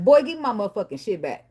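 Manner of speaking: demanding shout. Retold or imitated. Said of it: "That's what he said".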